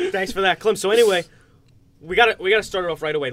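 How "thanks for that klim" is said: laughing. Real, not acted. Host laughs at nickname.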